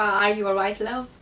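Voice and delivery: put-on a light British accent on a friendly greeting; English greeting. Asking the viewers about being greeted, in a light British accent. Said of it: "să vă spună cineva".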